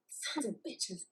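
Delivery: barely audible bitter mutter; muttered curse. Acted. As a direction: curse softly to yourself. Cursing under breath after bad news.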